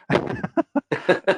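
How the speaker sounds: high-pitched amused laugh; real laugh. Real, not acted. Storyteller amused by his own blunt answer, high laugh. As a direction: laugh out at your own punchline.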